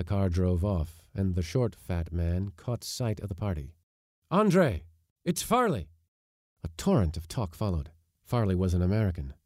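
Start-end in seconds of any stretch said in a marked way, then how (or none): 4.3-5.9 excited greeting shout; calling out. Acted. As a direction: call out to a friend you've just spotted. Man spots his friend, calls out.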